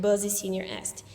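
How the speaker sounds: ordinary delivery, nothing marked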